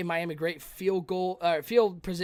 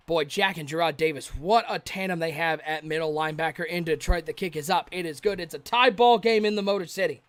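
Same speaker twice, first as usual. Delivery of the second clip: usual speaking voice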